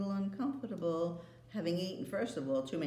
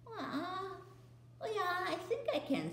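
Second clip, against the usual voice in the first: put-on high boyish voice, unsure; boy squinting to see; high uncertain boy answering the queen; Edmund; 12 semitones higher, 3 dB quieter than usual.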